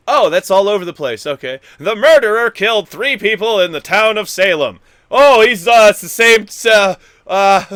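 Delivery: loud, high, swinging pitch, near shouting; swinging all over the place. Shown as a demonstration. Showing how his voice goes all over the place, loud and high, almost a shout. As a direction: swing wildly from calm to yelling. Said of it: "all up and down like".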